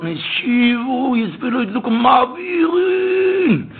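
high, drawn-out shouted cry; shouted prayer cry. Retold or imitated. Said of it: "hat geschrien dort".

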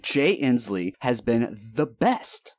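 slow, emphatic sarcastic gushing; mock praise.

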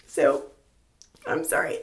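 hushed, choked-up apology; real tears, barely voiced; tearful. Real, not acted. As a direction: apologize through tears, voice nearly gone. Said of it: "can't say without crying".

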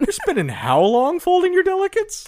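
high, disbelieving question; incredulous. Real, not acted. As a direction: ask in disbelief.

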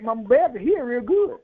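ordinary delivery

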